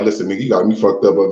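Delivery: very low, quick impersonation; imitated comedian, low and fast; Michael Blackson. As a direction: drop deep and rattle it off as the comedian. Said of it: "Michael Blackson, he like".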